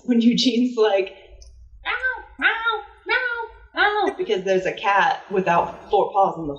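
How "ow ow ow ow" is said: high pitched cat "ow, ow"; mock yelps. Cat yelps as the owner slaps him, high yelps. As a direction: yelp in a high squeal. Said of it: "When Eugene yells".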